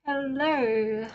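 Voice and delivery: usual speaking voice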